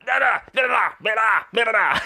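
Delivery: high babbling like a wild little animal; crazy animal noises. Retold or imitated. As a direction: mimic a kid acting like a crazy animal, up high. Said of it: "he's just like".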